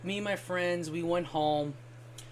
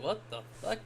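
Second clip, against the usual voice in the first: hushed aside; 2 semitones higher, 9 dB quieter, faster than usual. Retold or imitated. Muttered aside at a baby at a concert.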